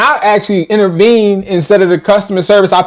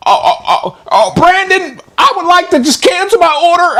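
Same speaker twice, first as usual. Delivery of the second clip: high whiny imitation of a customer; mock customer voice; 13 semitones higher than usual. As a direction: squeak it out like a fussy buyer. Mimicking customer's request.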